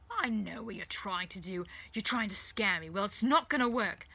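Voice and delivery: loud defiance building to a peak; forceful, rising refusal; angry defiance. Acted. Rose stands up to villain.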